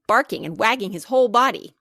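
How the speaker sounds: plain reading voice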